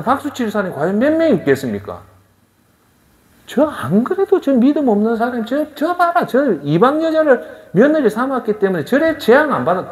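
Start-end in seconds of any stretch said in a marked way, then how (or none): 3.5-9.9 higher, scornful gossip; mocking townsfolk. Preacher playing townsfolk who jeer at Naomi, higher and scornful. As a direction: sneer at her like a village busybody.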